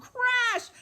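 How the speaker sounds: loud, high "Crash!"; sudden high burst for the children; crash sound. Acted. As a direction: call out the crash like a storyteller. Acting out the broken chair crash.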